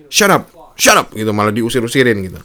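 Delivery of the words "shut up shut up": 1 mock rude order. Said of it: "kata-kata kasar dari si polisi".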